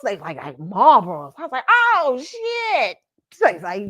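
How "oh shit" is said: high, stunned mock reaction; theatrical oh-no moment; retold dismay. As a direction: swear in hammed-up shock, pitch jumping. Retold shock at the news.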